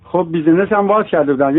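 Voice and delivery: normal talking voice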